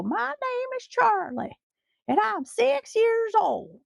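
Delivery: put-on Con Air kid voice. Quoting the Con Air note to annoy her dog, high child voice. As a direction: pipe it up like a small child reading a letter. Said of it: "the line from, uh, Con Air".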